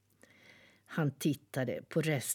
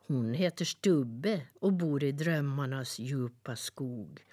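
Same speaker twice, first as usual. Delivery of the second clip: usual speaking voice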